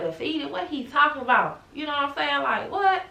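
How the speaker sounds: high, bewildered questioning; puzzled and out of it; confused surprise. Retold or imitated. Confused by doctor's word.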